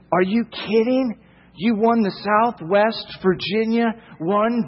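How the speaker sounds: high, excited disbelief; calling friends over in high-pitched mock awe; amazed apostle. Paul gushing to fellow apostles about your football title, amazed. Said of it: "And Paul immediately says".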